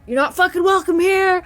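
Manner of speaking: high, loud warning at an unseen spirit; defiant shout. Retold or imitated. Alone in the house, ordering the spirit out, high loud shout. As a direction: yell at the ghost to get out.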